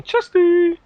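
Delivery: very high, drawn-out mock battle cry; imitated yell. Player recalls running around in a knife fight, imitated high yell. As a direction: call it out like a silly high-pitched war cry. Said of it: "As I was running around yelling".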